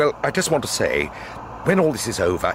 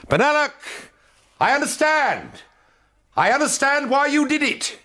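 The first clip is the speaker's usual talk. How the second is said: calling out. Acted. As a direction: shout to flush him out. Calling into a dark gallery.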